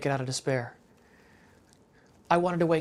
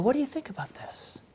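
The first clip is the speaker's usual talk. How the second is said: put-on whispered, wondering question to a child; hushed whisper; 5 semitones higher, 5 dB quieter, faster than usual. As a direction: lean in and whisper the question to the kids. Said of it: "they can go".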